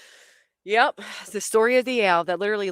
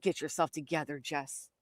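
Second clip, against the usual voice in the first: low, soft pep talk to herself; quiet self-talk; 4 semitones lower, 12 dB quieter than usual. Retold or imitated. Runner pulling herself together near a skunk, quiet self-talk. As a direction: murmur it to yourself, pitch dropped.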